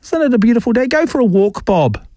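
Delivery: high, teasing sing-song aimed at Bob; mocking sing-song. Radio host taunting texter Bob, high mocking lilt. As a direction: needle him with a high singsong.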